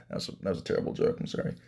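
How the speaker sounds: whispered apology after his own bad pun; breathy embarrassed aside, almost under the breath; sheepish whisper. Real, not acted. Vlogger whispering an apology to viewers for a bad joke, sheepish whisper.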